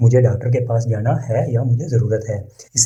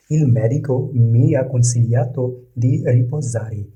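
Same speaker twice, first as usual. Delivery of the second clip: very slow, each word laid out for learners; slow model reading; much slower than usual. Shown as a demonstration. Teacher reading the Italian example to learners, slow and careful. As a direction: read it slowly so students can repeat. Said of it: "teacher models Italian sentence".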